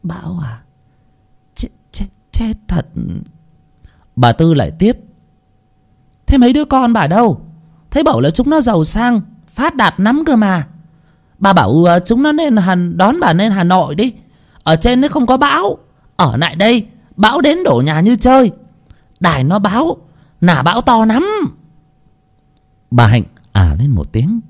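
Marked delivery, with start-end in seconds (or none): 0.0-2.9 soft, feeble dismay; hushed, halting elderly worry; clucking old woman. A narrator's reading. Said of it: "chép miệng". 6.3-21.3 high, insistent busybody voice; high-pitched, emphatic urging for someone hard of hearing; nagging neighbor, urgent. A narrator's reading. Neighbor lectures deaf old woman.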